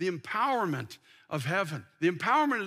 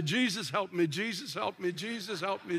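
Jesus, help me repeated in steady rhythm, fading softer; rhythmic running prayer; 3 semitones lower, 4 dB quieter than usual. Retold or imitated. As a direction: chant it in time with running steps. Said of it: "I got a rhythm in it".

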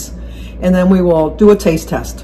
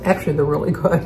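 talking with a mouth full of food; words round a bite of chocolate ball; 4 semitones lower, 9 dB quieter, faster than usual. Real, not acted. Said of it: "You are".

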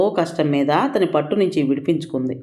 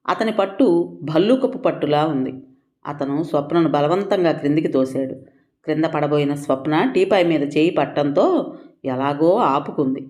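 usual speaking voice

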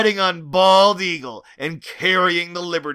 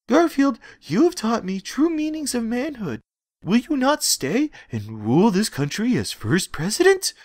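begging; 7 semitones higher than usual. Acted. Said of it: "asked with begging".

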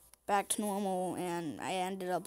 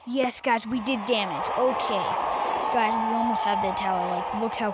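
excited; 7 dB louder than usual. Real, not acted. Damage finally lands, cheering.

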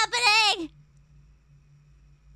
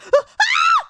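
high loud startled shriek; startled scream; 9 dB louder than usual. Real, not acted. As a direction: scream at the jump scare. Jump scare.

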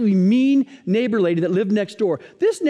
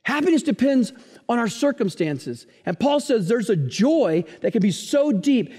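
ordinary talk, nothing marked

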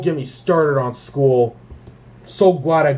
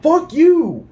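much higher, angry curse then spat-out insult; road rage cursing; 10 semitones higher, much slower than usual. Retold or imitated. Cursing at the driver who cut in, furious.

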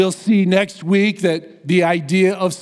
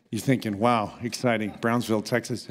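deadpan unimpressed; 6 semitones lower, 8 dB quieter, faster than usual. Retold or imitated. Mocking the congregation's unimpressed reaction.

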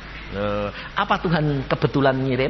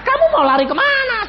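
retold scolding; 7 dB louder than usual. Preacher scolding his crew.

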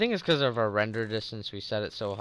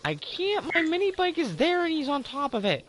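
high-pitched frantic yelp; panicked high voice; 5 dB louder than usual. Real, not acted. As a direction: squeal it in panic. Bear on his bike.

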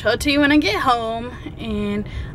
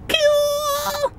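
high squeal. Real, not acted. Excited exclamation after intro.

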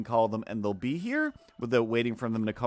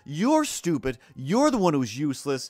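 louder, higher imitation of a screaming neighbor; mock furious rant; 4 semitones higher, 4 dB louder than usual. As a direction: hurl the insults as she did. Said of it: "Her rebuttal".

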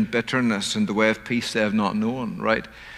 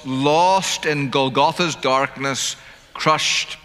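ordinary delivery

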